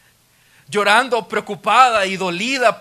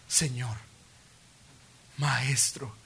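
breathless hushed recognition; a whispered, awestruck Mary; awed whisper; 6 semitones lower, 8 dB quieter, much slower than usual. Retold or imitated. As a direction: whisper it as if you can hardly believe it.

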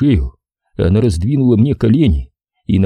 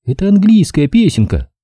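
put-on higher girl's voice, a touch lecturing; 10 semitones higher than usual. A narrator's reading. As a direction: instruct him primly, lightly voiced young woman. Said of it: "поучающе заметила она".

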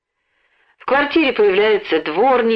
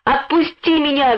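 higher-pitched narrated shout; desperate cry; 7 semitones higher than usual. A narrator's reading. Boy struggles in mother's grip, crying out. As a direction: cry out to be released. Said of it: "закричал Лёнька".

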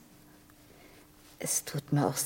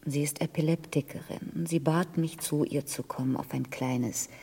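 ordinary talk

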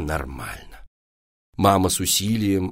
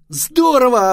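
very high, loud cheer; gleeful boy's outburst; thrilled exclamation; delighted; 9 dB louder, slower than usual. A narrator's reading. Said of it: "обрадовался Вася".

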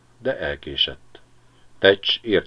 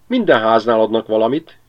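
a magpie's cheeky chatter, a bit higher and louder; 4 semitones higher, 7 dB louder than usual. A narrator's reading. (szemtelenül cserregő szarka)

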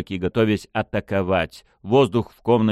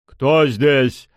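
loud, higher challenge to an unseen intruder; stern demand; 6 semitones higher, 6 dB louder than usual. A narrator's reading. Pushkin challenges an unseen intruder in the room, stern demand. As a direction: call out sharply into the room. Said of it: "повторил Пушкин, хмуря брови".

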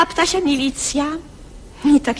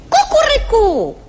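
loud, high rooster crow; angry rooster crow; 9 semitones higher, 4 dB louder than usual. Acted. Rooster crowing angrily from his perch, loud and high. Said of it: "kogut na patyku zapiał gniewnie".

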